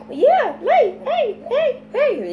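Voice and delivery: high, bright hey hey to the babies. Real, not acted. Mom entertains her twins with high excited heys.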